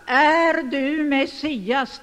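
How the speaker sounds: loud, drawn-out formal question; high priest questioning the accused; solemn interrogation. Acted. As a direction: demand an answer, slowly and loudly. Acts high priest's interrogation of Jesus.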